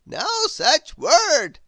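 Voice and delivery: high, slow, loud wizard; a grand old wizard, astonished. Acted. The Wizard puzzled at Grizzle's noises, high wizard voice.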